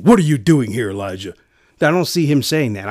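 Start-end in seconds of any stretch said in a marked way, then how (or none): 0.0-1.3 loud, sharp demand on "What are you doing here"; harsh scolding. Acted. God rebuking Elijah the way the preacher rejects, loud. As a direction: bark the question like an angry father. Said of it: "I don't imagine him saying".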